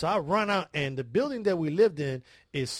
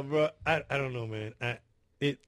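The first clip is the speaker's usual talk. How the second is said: ordinary delivery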